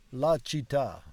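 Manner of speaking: usual speaking voice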